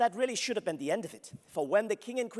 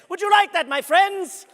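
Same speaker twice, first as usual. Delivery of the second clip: calling out to the crowd; 13 semitones higher, 12 dB louder than usual. Acted. Rousing the audience.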